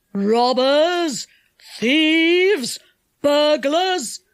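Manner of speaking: angry enchanter's drawn-out shouts; loud shout. A narrator's reading. Enchanter discovers strangers in his cellar, shouting. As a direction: shout each accusation out. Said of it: "shouted the enchanter in a loud voice".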